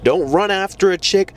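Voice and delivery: ordinary delivery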